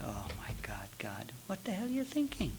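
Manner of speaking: hushed, unvoiced whisper of disbelief; retold shocked whisper. Teacher retells being bitten by the dog, whispering in shock. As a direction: whisper it as if stunned by the bite.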